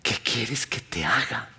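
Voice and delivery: slow, low, near-whispered question; soft, drawn-out whispery asking; hushed question. Acted. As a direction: ask it softly and deliberately. Repeats Jesus's question slowly, softly.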